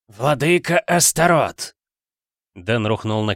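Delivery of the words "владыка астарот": begging in terror; frightened plea. Acted. Дэн в ужасе падает на колени. (испуганная мольба)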